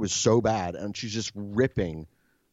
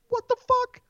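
incredulous outburst; 5 dB louder than usual. Real, not acted. Disbelief at the hired cleaner.

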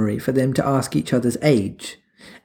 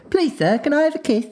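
high, pleading orphan voice; Oliver Twist; 14 semitones higher than usual. Retold or imitated. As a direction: beg like a hungry orphan. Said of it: "the Oliver Twist".

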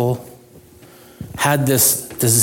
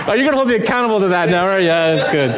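laughing; 3 semitones higher, faster than usual. Real, not acted. Joking with congregation, laughing.

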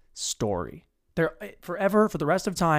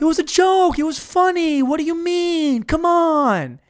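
high-pitched, loud protesting; mock indignant guy; 12 semitones higher, 7 dB louder than usual. Guy defends his funeral stunt to his date, hammed-up high protest. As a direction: whine it loudly way up high, hammed-up.

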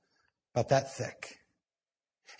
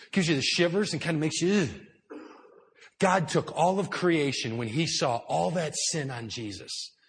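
normal talking voice

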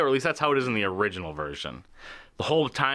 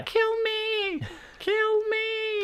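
tiny voice shrieking, much higher; terrified horror victim; much slower than usual. Retold or imitated. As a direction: squeal it out like a trapped little creature.

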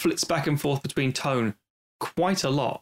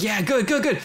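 much higher, louder eager cheer; gleeful cheering; 9 semitones higher, 4 dB louder than usual. Retold or imitated. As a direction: egg on the hero. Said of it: "It's like".

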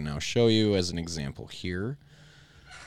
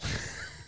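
high laugh; 10 dB quieter than usual. Real, not acted. Joke about eth in future.

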